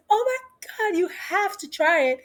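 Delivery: gushing. Retold or imitated. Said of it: "she was like".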